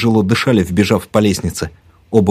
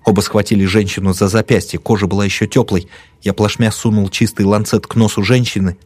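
ordinary delivery

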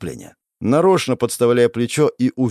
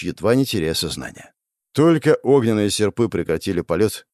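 plain reading voice

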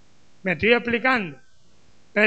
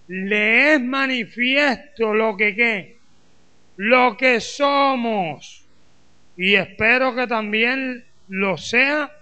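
slow, drawn-out pulpit reading; emphatic scripture reading; slower than usual. Acted. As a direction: read the verse out phrase by phrase, leaving room for the congregation. Reads scripture aloud to congregation.

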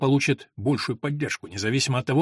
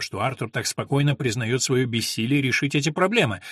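plain reading voice

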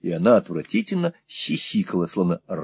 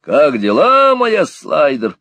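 loud, high, drawn-out hail; very loud shout; 7 semitones higher, 7 dB louder, slower than usual. A narrator's reading. Squeers greets deaf Peg from up close, shouting. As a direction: bellow it slowly for deaf ears. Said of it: "заорал очень громко".

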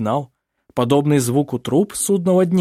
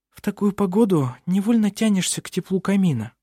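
put-on lively young woman voice; 7 semitones higher than usual. A narrator's reading. Vinga speaks.

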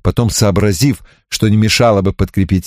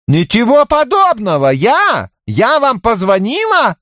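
indignant denial; slower than usual. Acted. Zorka denies the call.

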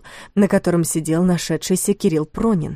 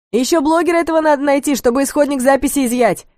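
shouting after them; 8 semitones higher than usual. A narrator's reading. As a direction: call out across the room. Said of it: "успел крикнуть им вслед Велесов".

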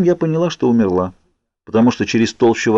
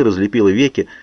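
ordinary delivery

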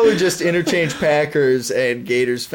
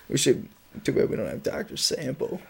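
ordinary talk

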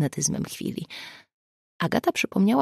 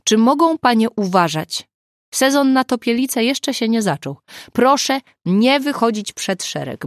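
raised, higher scolding; louder, pleading reprimand; exasperated plea; 6 semitones higher, 5 dB louder than usual. A narrator's reading. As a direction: admonish them like a harried policeman. Exasperated policeman scolding the women.

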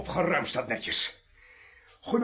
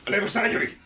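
loud order to stay put; sharp command; 3 semitones higher, 5 dB louder than usual. Acted. Gunman halting the group, sharp command. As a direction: order them firmly to freeze.

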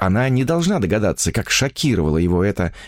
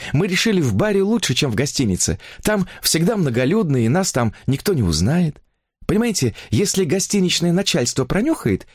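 higher-pitched chattering heroine; infatuated young woman; 9 semitones higher than usual. A narrator's reading. Woman confiding her new romance to a writer, higher enraptured voice.

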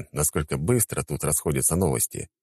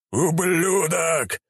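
roared curse; 12 semitones higher, much slower than usual. Acted. Attacker roars at the prisoner, a drawn-out furious yell. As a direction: bellow it as you lunge. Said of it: "проорал он".

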